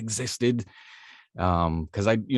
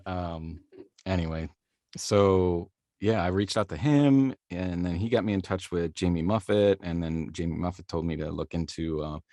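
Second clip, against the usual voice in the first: usual speaking voice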